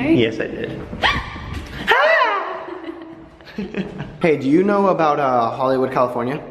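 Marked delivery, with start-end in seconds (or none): 0.8-3.1 high shrieking laugh. Real, not acted. Laughs after scaring her.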